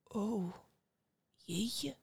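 soft, awed murmur; small, stunned aside; amazed thought. A narrator's reading. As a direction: think it aloud in hushed wonder. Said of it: "dacht Antoine".

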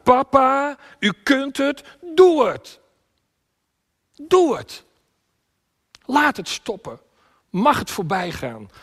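very high, loud cries, easing at the end; crying out. Acted. Preacher as Jesus shouting Doe het to Papa, crying out. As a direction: scream the plea to heaven in bursts. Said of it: "Hij schreeuwt het uit".

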